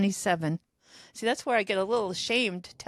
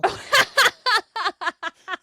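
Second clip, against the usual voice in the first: bursts of high laughter; loud laugh; 12 semitones higher, 8 dB louder than usual. Real, not acted. Laughing at own prank.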